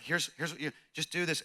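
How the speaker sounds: soft, gentle uncle giving instructions; gentle coaxing. Acted. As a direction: coax a nervous child softly. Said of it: "he's so gentle".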